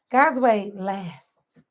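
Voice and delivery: drawn-out, deliberate; slow emphatic motto. Real, not acted. Preacher driving home her motto, slow and deliberate. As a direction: lean on each word slowly. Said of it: "repeated motto slowed".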